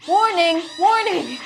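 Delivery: high, loud warning call; urgent warning. Acted. Tattoo warning people about permanent tattoos, high urgent call. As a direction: sound the alarm, high and loud.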